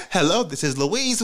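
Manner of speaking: polite, composed mock telephone greeting; angelic phone voice. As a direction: switch to an angel answering the phone. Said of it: "to angel in three seconds".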